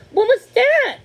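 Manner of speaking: alarmed high shout. Acted. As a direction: jump at the sudden noise. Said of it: "Thumbelina cried in alarm".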